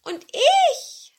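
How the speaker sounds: very high, loud meow; high-pitched talking cat; old cat. Acted. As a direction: draw out a high cat yowl. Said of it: "sagte die ururalte Katze".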